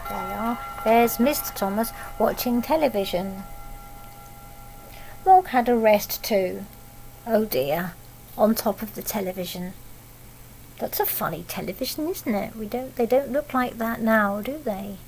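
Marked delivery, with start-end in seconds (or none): none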